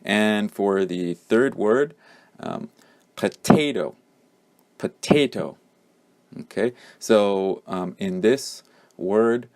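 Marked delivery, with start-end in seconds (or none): 3.1-5.6 "potato" as po-TAY-toh, voice dropping on the last syllable; second-syllable stress. Shown as a demonstration. Teacher models the word twice, second-syllable stress on "potato". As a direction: hit the middle of "potato", step down on the end. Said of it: "the second syllable is stressed".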